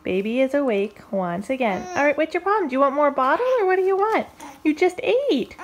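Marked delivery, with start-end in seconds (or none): 2.0-5.6 higher and louder, playfully fed up; mock exasperation. Mom playfully scolding her fussy baby, mock exasperation.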